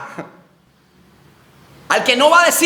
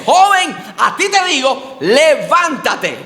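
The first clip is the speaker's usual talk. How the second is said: commanding call; 4 semitones higher, much slower than usual. Acted. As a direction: raise the dead with a command. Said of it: "y le dice".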